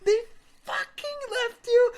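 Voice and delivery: high, loud, outraged disbelief; seeing red. Retold or imitated. Storyteller learning his friend was left behind, high outraged yell. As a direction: re-enact an incredulous furious outburst. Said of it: "I was seeing fucking red".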